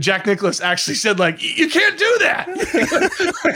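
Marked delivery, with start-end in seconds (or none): 1.4-3.5 much higher, slow and stunned, with a laugh; a jaw-dropping cartoon disbelief; shocked. Jack Nicklaus stunned at Jacklin's lineup, high slow disbelief. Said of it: "he was so shocked".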